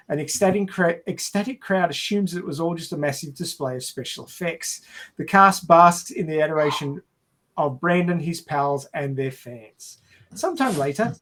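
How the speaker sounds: normal talking voice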